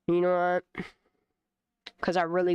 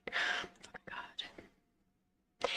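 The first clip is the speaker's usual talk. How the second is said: whisper; 12 dB quieter, much slower than usual. Real, not acted. Exasperated aside.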